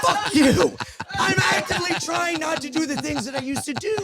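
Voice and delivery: high-pitched, exasperated laughing outburst; comic exasperation; laughing protest. Real, not acted. Protests teasing while laughing.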